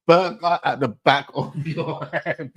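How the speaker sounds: slow, breaking into laughter mid-line; can't get through the line without cracking up; quoting it through real laughter; laughing quote. Retold or imitated. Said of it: "Man just said".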